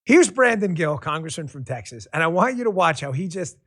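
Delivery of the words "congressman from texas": ordinary talk, nothing marked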